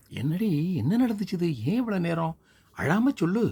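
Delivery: high, loud pleading demand; frantic mother begging her to speak; anxious mother. Acted. Mother anxiously pressing daughter.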